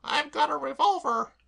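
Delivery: high, chirpy cockney maid voice; perky maid reassuring her mistress; Claire the maid. Acted. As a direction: pitch her up and pert.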